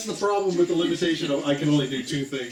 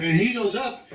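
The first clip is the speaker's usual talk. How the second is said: rising pitch; 7 semitones higher, 4 dB louder than usual. Acted. Narrating the pixie rising.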